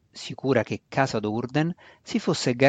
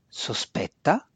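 growled; slower than usual. A narrator's reading. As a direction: growl it with distrust. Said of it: "ringhiò matrona Sina Fai". (ringhiato con sospetto)

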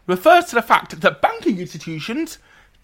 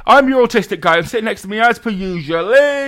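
show intro; 7 dB louder than usual. Acted. Podcast host opening the show.

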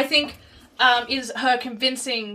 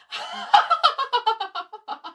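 deadpan laugh; 10 semitones higher than usual. Shown as a demonstration. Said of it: "I can also laugh without smiling".

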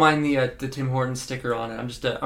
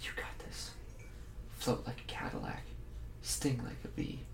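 whispered pep talk; 4 semitones lower, 17 dB quieter than usual. Acted. Jumper psyching himself up alone.

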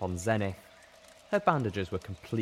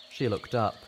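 usual speaking voice

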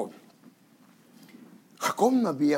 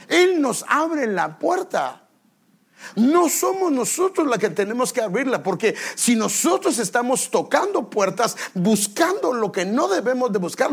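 raised, high preaching voice; full-force preaching; 8 semitones higher, 7 dB louder than usual. Real, not acted. Preacher telling the congregation God opens doors, full-force preaching. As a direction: drive it home to the congregation, loud and high.

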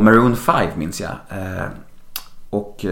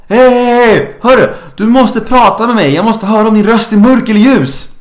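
much higher and louder frantic shout; panicked yelling; 11 semitones higher, 7 dB louder than usual. Retold or imitated. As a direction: yell desperately at the cashier. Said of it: "skriker till tjejen i kassan så här". (panikslagen skrik till kassörskan)

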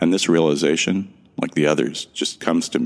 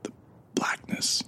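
whispered, barely getting the words out; frightened whisper; 11 dB quieter, slower than usual. Acted. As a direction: breathe the words out in fear, almost no voice. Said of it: "they barely come out as a whisper".

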